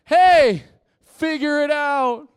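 high, loud, drawn-out mock shout; mock careless God. Pastor mocking a careless God, loud high mock call. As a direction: lampoon a God who shrugs off his people. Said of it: "He wasn't like".

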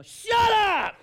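high, explosive yell; explosive shout. Pretend. Customer suddenly yells at a tempting thought. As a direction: snap it out as a sudden outburst.

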